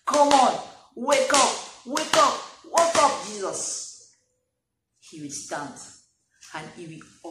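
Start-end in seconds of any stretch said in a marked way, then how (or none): 0.1-3.9 loud, urgent plea; calling out to Jesus. Acted. Believer calling Jesus awake in the storm, loud and urgent. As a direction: shout to wake him. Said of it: "is to wake him up".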